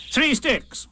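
high, prompt rabbit voice. A narrator's reading. Rabbit answering Eeyore's question about sticks, prompt. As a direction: answer straight off, pitched right up. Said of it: "said Rabbit promptly".

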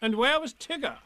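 lightly voiced fussy rabbit. Rabbit asks.

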